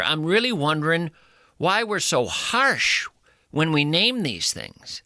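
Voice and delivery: ordinary talk, nothing marked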